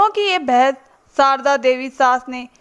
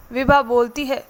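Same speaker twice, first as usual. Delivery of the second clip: ordinary delivery, nothing marked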